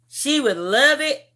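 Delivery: ordinary talk, nothing marked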